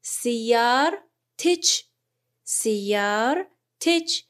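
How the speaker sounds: سیار تچ said very slowly, "sayyaratech"; slow Arabic demo. Shown as a demonstration. Teacher modelling سیار تچ as "sayyaratech" for students, slow. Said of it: "ماشینت".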